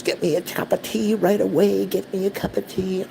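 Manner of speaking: mimicking demanding mom. Voicing mom's demand in story.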